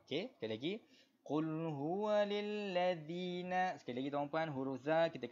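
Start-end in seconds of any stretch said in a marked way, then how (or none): 1.3-3.5 slow, higher melodic tajwid chant; Quran recitation. Shown as a demonstration. Teacher reciting verse for the class, slow melodic tajwid. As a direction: recite for students to follow.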